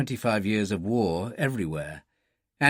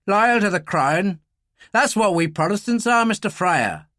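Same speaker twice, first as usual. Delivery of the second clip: raised-pitch, indignant Irish loyalist; genuine anger; 7 semitones higher, 4 dB louder than usual. A narrator's reading. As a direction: declare it with angry conviction. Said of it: "genuine anger in his voice".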